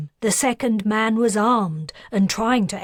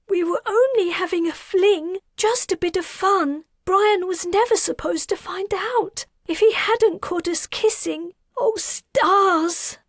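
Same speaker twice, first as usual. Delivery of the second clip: much higher, crying, broken voice; tearful croak; 12 semitones higher, 3 dB louder than usual. A narrator's reading. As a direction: plead through tears. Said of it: "She croaked".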